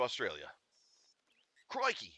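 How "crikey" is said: hammed-up Australian Crikey, pitched higher; bad mock Aussie exclamation; a cheesy tourist's Australian Crikey; awful Australian accent.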